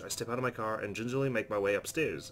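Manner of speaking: ordinary talk, nothing marked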